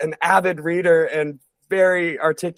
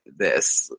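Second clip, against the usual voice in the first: scratchy sick voice; 12 semitones lower than usual. Shown as a demonstration. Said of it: "my voice was, like, kinda scratchy".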